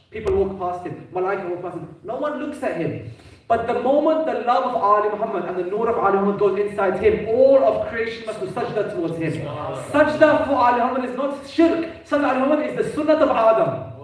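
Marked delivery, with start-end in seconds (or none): 3.5-13.9 raised, higher preaching voice; impassioned preaching. Real, not acted. Preacher tells congregation all creation must bow, impassioned. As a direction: drive the point home to the congregation, louder.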